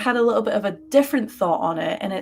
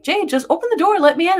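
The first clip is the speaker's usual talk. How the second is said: insistent dad; 9 semitones higher, 6 dB louder, faster than usual. Retold or imitated. Dad insisting through the buzzer.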